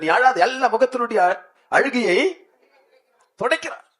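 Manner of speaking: high, loud, driving sermon voice; fiery preaching. Acted. Pastor urges the congregation to declare Jesus within them, loud and high. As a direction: shout it out to stir the church.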